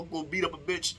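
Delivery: ordinary talk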